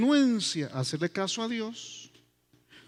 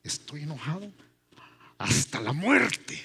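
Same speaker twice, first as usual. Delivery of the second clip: sulky Jonah. Acted. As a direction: stretch out the sulk to the end. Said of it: "es una frase tremenda".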